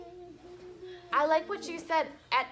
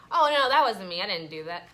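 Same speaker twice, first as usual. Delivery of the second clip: put-on denying kid; 5 semitones lower, 7 dB louder, faster than usual. Shown as a demonstration. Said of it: "lie about it".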